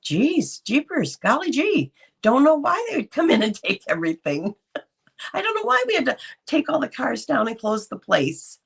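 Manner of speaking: high, wide-eyed fake innocence with a laugh; mock innocent Josh. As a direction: play dumb in a high sing-song. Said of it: "Josh could have told his whole family".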